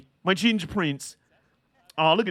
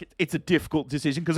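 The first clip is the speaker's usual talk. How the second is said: ordinary delivery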